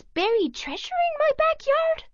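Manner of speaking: very high astonished squeak; surprised dog. A narrator's reading. Dog learning of buried treasure, high amazed voice. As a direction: gasp it out in high disbelief.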